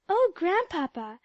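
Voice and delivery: bright high cry of joy; a glad little exclamation; exclaiming. A narrator's reading. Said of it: "exclaimed Polly".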